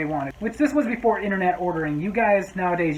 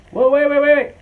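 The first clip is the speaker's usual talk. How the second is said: loud, high, urgent call; startled shout; 8 semitones higher, 9 dB louder than usual. Retold or imitated. Customer calling the waiter back with a loud high shout. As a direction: stop the waiter dead with a shout. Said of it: "this customer stops me by going".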